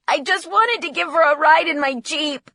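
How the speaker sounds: high, loud protest; distraught defence. A narrator's reading. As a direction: explain yourself in upset. Distraught son defends himself.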